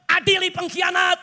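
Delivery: high-pitched imitated chant; retold crowd cry. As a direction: mimic protesters calling out, held back in volume. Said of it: "Orang lagi teriak". (menirukan seruan massa dengan nada tinggi)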